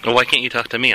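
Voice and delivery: ordinary talk, nothing marked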